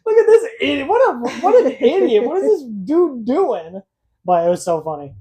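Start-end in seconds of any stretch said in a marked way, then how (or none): 0.1-3.8 mocking coworker. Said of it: "you were just like".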